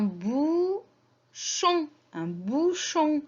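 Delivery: "bouchon" slowly split into "bou" and "chon"; teacher sounding out "bouchon" very slowly; syllable by syllable. Shown as a demonstration. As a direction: stretch both syllables of "bouchon". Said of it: "la syllabe manquante".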